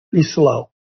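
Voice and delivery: "Be slow" dropped low and soft; soft low emphasis. Shown as a demonstration. Host correcting the misreading, low and soft. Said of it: "It says".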